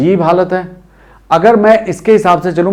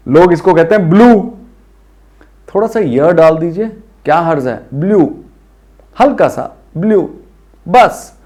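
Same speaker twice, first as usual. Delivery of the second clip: ordinary delivery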